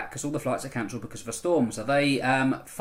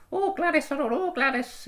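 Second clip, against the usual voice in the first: put-on much higher old lady voice. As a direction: squeak it out like a doddery old lady. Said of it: "It's just my old person voice".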